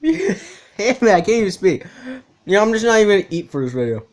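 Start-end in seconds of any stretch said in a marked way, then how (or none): 0.0-1.0 laughing, pitch jumping high; laughing real curse, pitched high. Real, not acted. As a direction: curse through a laugh at the pain. Laughing curse after biting tongue.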